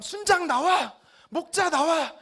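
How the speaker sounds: loud, high-pitched furious order; angry summons. Acted. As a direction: shout to haul the leaders out. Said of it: "너무 화가 났어요".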